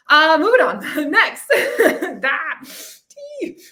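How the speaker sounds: real laugh while trying not to cry, high and loud; laughing back tears. Real, not acted. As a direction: laugh it off before the tears come. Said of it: "I'm trying not to cry here".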